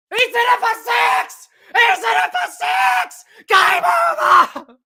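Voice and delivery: screaming high, climbing to a peak; ecstatic winning call. Real, not acted. Commentator to viewers as the game is won, ecstatic scream. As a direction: scream the winning six, voice straining upward. Winning hit called live.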